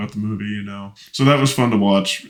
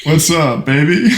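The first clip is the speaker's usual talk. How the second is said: excited laughing greeting; 8 semitones higher, 9 dB louder, slower than usual. Real, not acted. Echoing the segment name, laughing.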